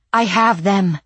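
panting, breathless and loud; out of breath. Acted. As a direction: gasp it out after running. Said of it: "she panted".

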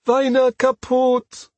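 high, loud, drawn-out cry of despair; desperate cry. A narrator's reading. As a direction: cry out slowly in anguished protest. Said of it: "her desperate call".

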